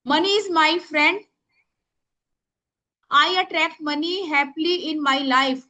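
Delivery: very slow, word by word; slow dictation to the class. Shown as a demonstration. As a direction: spell it out for writing. Dictating prayer.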